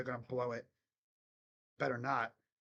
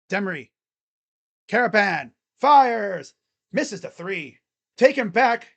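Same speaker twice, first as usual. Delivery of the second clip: play-by-play; 4 semitones higher, 12 dB louder than usual. Acted. Live play-by-play of a game.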